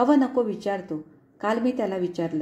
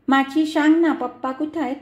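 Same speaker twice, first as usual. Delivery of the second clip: put-on high, louder little child begging; pleading toddler; 6 semitones higher, 5 dB louder than usual. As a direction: coax her like a small boy asking for his father.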